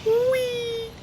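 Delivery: very high, thin "whee"; playful squeal. Real, not acted. Player squeals while playing the game, high playful "whee". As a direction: let out a soft, high-pitched squeal.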